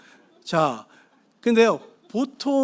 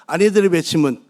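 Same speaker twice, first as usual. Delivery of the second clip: a rallying cry, louder and measured; loud proclaimed slogan; 2 semitones lower, 6 dB louder than usual. Shown as a demonstration. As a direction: proclaim it for the crowd to repeat. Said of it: "자, 따라서 하십시오".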